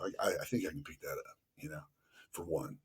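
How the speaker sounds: thinking it to himself under the breath; hushed, rushed self-talk; private thought. Retold or imitated. Inner thought while watching.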